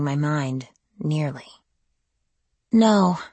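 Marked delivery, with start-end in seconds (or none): 2.7-3.2 whimpering. A narrator's reading. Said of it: "I whimpered".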